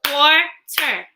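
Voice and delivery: "Quarter" clapped out in two beats; two distinct parts in "Quarter", with a gap between; syllable break. Shown as a demonstration. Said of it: "Break words in two parts".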